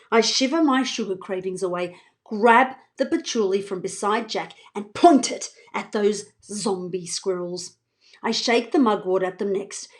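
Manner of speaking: usual speaking voice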